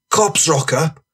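high, indignant-sounding lad's yarn; rowdy storyteller. Acted. Voices Franny continuing his tale.

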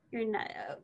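a whispered, faltering correction; hesitant whisper. Acted. Teacher whispering as he dares to correct Joey. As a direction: drop to a whisper, not sure how to say it.

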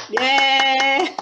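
loud, drawn-out hurrah; cheering. Pretend. Storyteller celebrating the rocket game, loud cheer. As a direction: cheer it out long for the children.

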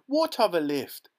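high, loud panicked shriek; alarmed scream. A narrator's reading. Mrs Teavee screaming at Mr Wonka, high and loud. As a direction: shriek it in sudden fright. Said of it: "screamed Missus Teavee".

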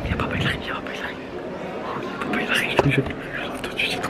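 frightened whisper while hiding; scared whisper. Real, not acted. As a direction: whisper so the seeker can't hear. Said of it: "J'ai vraiment trop peur".

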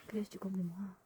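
hushed whisper. Real, not acted. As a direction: murmur it under breath. Unvoiced whisper.

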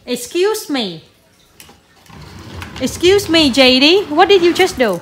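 playful parental telling-off; mock scolding. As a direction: call the child out, loudly affronted. Mother mock-scolds the child's mischief.

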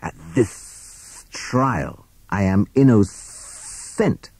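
slow snake voice with stretched S sounds; drawn-out sibilant protest; hissing snake. A narrator's reading. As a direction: hiss it out like an accused snake. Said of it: "hissed Hissing Sid".

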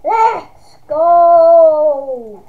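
loud victory shout; excited cheer. Real, not acted. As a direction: yell it out after winning. Cheering a win.

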